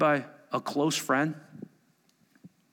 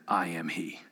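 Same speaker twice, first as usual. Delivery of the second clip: I am he, dropped much lower and softer, a deep hushed awe; hushed thunder; 11 semitones lower, 8 dB quieter than usual. Acted. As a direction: let it rumble low like hidden power. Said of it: "Do you wonder if he thundered".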